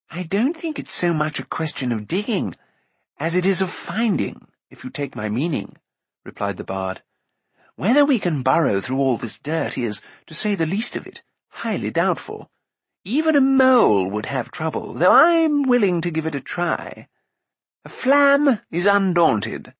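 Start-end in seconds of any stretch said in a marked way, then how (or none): 0.1-5.8 higher, lilting bard voice; voluble bard. Acted. Bard replying to youth about digging, wordy. 7.7-19.7 high, sweeping bard voice; voluble, undaunted bard. Acted. Bard doubting the dig but willing, declaiming.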